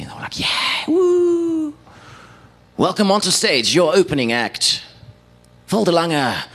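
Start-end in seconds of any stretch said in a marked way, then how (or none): 0.4-1.7 high mock cheering whoop; crowd going crazy. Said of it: "the whole crowd just went crazy". 2.8-6.4 high, big announcer build-up; stage announcer introduction. Retold or imitated. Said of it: "this manliest manly voice was like".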